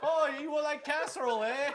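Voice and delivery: high, hammed-up Midwestern lilt; mock Midwest accent. Host teasing about Midwesterners and casserole, mock Midwest accent. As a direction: overdo a folksy Midwest accent. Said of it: "That was probably the Midwest equivalent".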